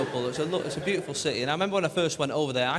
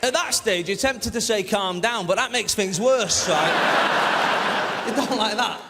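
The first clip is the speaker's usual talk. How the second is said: much louder, higher comedic rant; 6 semitones higher, 7 dB louder, slower than usual. Acted. Comedian ranting to the crowd about Liverpool, loud. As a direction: shout it out like a ranting comic.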